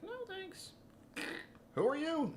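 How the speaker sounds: high-pitched mock refusal; wary stranger. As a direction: imitate people politely backing away in a high voice. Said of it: "people would be like".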